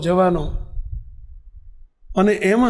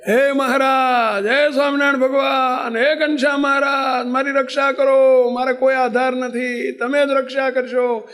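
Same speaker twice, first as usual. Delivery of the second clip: desperate prayer; 3 semitones higher than usual. Retold or imitated. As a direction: plead like a man hanging over a cliff, voice breaking. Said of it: "કેવું ભજન થાય?". (ભયભીત થઈ રડતા અવાજે ભગવાનને પોકાર)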